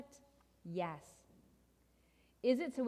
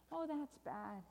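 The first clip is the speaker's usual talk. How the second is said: soft, hushed dismay; sorrowful refrain; 5 semitones higher, 6 dB quieter, slower than usual. Acted. Storyteller reacts to boy missing parents, soft sorrow. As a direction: sigh over the bad news.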